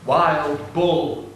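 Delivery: ordinary delivery, nothing marked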